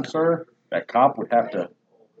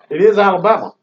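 loud, higher punchline; emphatic answer; 7 semitones higher, 9 dB louder than usual. Real, not acted. Telling his cohost the state, loud and emphatic. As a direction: announce it big and loud.

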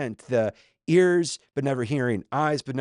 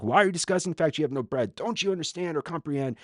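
quick, higher-pitched volley of questions; rapid-fire questioning; 4 semitones higher, faster than usual. Acted. Jesus grilling disciples about bread, rapid-fire. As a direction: fire the questions off without a gap. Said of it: "you just get this".

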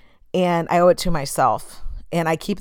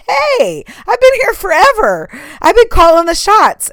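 much higher, louder, a pushy inner voice that has run the show for years; bossy inner voice; 12 semitones higher, 8 dB louder than usual. Acted. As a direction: play the old bossy part of yourself, loud and indignant. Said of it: "that part of me is like".

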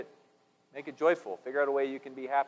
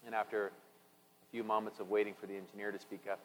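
ordinary talk, nothing marked

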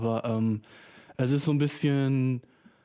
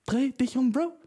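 mock command; 11 semitones higher than usual. Repeats retort.

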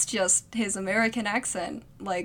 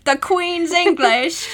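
mock British accent; 4 semitones higher, 3 dB louder, slower than usual. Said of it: "my British accent".

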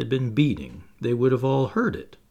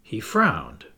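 usual speaking voice

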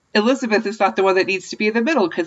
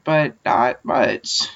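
slow and low; 7 semitones lower, much slower than usual. Real, not acted. Slow trailing aside.

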